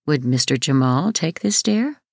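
ordinary delivery

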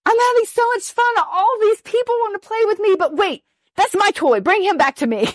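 put-on high, fast, gleeful child's voice turning possessive; inner child voice. The pure child at a party, gleeful then clingy and high-pitched. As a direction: play the delighted kid who suddenly wants her toy back. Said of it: "And then you're like".

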